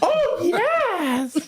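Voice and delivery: gleeful squeal. Retold or imitated. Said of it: "seeing that and going".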